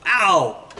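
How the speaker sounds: a loud, hammed-up "ow!"; mock yelp of pain. Teacher fakes a yelp as a special effect, hammed-up and loud. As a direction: yelp as if the light burned you.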